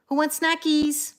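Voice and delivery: high, drawn-out treat call; calling the cats. Shown as a demonstration. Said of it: "now I sound like a weirdo".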